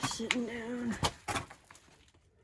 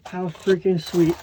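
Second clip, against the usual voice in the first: excited; 12 dB louder, slower than usual. Real, not acted. Amazed at pottery find.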